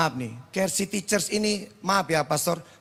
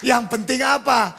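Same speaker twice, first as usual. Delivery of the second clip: raised, higher sermon delivery; fervent preaching; 8 semitones higher, 4 dB louder than usual. Real, not acted. Preacher quotes Isaiah to congregation, fervent proclamation.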